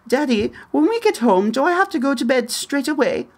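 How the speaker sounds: put-on high-pitched little kid with a British lilt; child's British accent. Child asking Daddy about bedtime in a high, put-on British accent. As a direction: mimic a small child putting on Brit English. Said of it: "lapses into a slight Brit accent".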